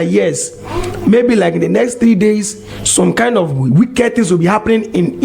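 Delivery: normal talking voice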